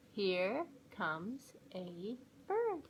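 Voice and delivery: slow, careful early-reader voice; reading to children. A narrator's reading. Mom reading a level-four book to kids, slow and clear. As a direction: read it out word by word for young kids.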